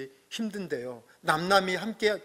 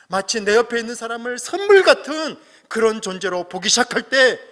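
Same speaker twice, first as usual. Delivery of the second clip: rising, louder pulpit appeal; impassioned preaching; 6 semitones higher, 8 dB louder than usual. Acted. Pastor urging the congregation to value each other, in full preaching voice. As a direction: preach it out to the whole church.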